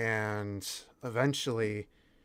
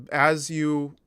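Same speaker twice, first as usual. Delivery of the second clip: usual speaking voice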